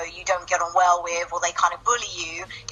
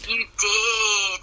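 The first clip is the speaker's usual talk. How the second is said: normal talking voice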